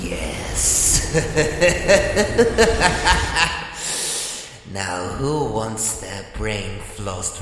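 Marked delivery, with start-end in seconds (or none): 1.2-4.7 loud, high maniacal laugh; evil laugh. Acted. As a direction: cackle in triumph. Villainous triumph.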